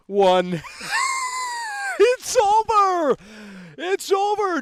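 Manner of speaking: high, loud victory yell with a shrieking laugh; hammed-up gloating scream; triumphant shout. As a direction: roar it out like the countdown just hit zero. Countdown climax, exposing PlayStation.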